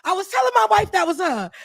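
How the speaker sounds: high, shouted, thrilled recognition; excited fan. Retold or imitated. Fan tells Rebecca he spotted her, excited shout. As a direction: blurt it out like a starstruck fan. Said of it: "he was like".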